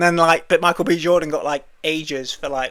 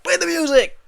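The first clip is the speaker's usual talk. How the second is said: high, loud mock command; imitated shout to the band; mock order; 13 semitones higher, 5 dB louder than usual. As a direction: call out the cue over the speeches. Acts order to cut speeches.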